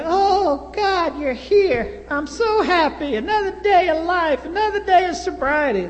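put-on a frail old man thrilled to be alive; dying old man happily greeting his visitors; joyful dying man.

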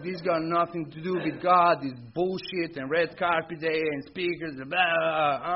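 loud, angry rant at the situation; furious rant. Retold or imitated. Furious at the car break-in, ranting loudly. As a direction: vent the fury, rising in volume. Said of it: "I am furious".

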